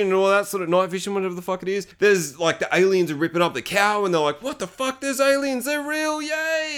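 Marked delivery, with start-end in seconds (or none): 4.4-6.8 very high giddy voice; hammed-up high-pitched glee; mock excitement. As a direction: squeal it like thrilled fans, pitch climbing. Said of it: "they're all excited".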